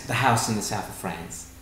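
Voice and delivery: usual speaking voice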